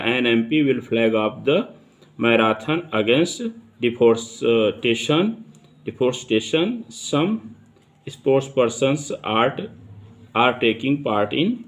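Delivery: slow, deliberate example sentence read; dictation reading. Shown as a demonstration. Teacher reading an example sentence to students, slow and deliberate. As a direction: read it out slowly, word by word for the class.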